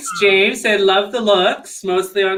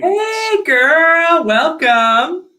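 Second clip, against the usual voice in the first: high, loud, drawn-out welcome; excited greeting; 10 semitones higher, 4 dB louder, much slower than usual. Real, not acted. Host welcoming a viewer to the stream, excited. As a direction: call out a big swooping hello.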